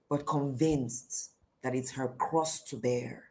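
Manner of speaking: usual speaking voice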